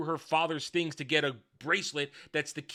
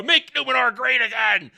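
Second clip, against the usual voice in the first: mock slogan; 7 semitones higher, 6 dB louder than usual. Mocking slogan chant.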